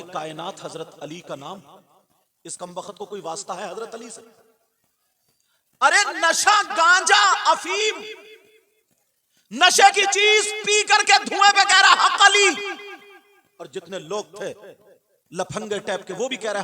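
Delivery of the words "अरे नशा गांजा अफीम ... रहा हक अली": high, loud indignant declamation; full-force preaching. Real, not acted. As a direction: thunder the denunciation to the crowd.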